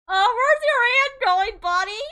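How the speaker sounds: real excited squeal; squealing tease. Real, not acted. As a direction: shriek a teasing question. Teasing high voiced reaction.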